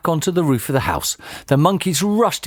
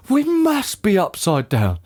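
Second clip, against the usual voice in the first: raised pitch, astonished husband; shocked gasp; 7 semitones higher than usual. A narrator's reading. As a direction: work it out in amazement. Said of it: "gasped Mister Twit".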